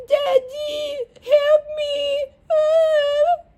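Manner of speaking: high, far-off whiny sob, mocking a rival; hammed-up fake crying like a helpless little girl. Mocking Beta calling her dad for help, high fake sob. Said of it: "And she'd be like".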